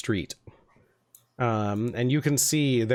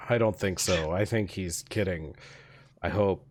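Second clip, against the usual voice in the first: low, quieter doubtful guard; second guard; 6 semitones lower, 4 dB quieter than usual. Acted. Guard reassuring the scared partner, doubtful. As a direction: answer your partner low and unsure.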